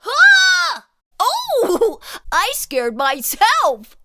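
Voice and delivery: high, loud startled squeal. Acted. Splat startled by his own reflection, a high loud squeal. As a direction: squeal in fright at the mirror. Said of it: "jumped back with a squeal".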